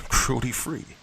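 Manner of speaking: mock the phrase "Cruelty-free?" in disbelief; derisive echo. A narrator's reading. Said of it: "I laughed derisively".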